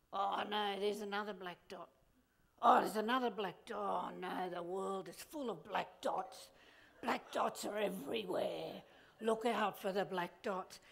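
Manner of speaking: low, mopey doom-sayer; gloomy low moaning voice; moping pessimist voice. Retold or imitated. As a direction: whine about every little flaw.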